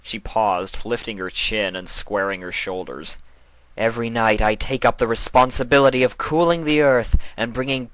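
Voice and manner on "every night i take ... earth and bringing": higher, louder regal proclamation; proud Nightmare Moon. Acted. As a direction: declare your sacred duty. Nightmare Moon declares her duty.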